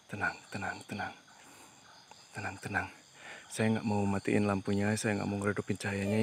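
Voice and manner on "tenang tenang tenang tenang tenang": very soft, half-whispered reassurance; hushed calming. Real, not acted. Ghost hunter soothing the spirit that came down, hushed. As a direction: keep it low and barely voiced.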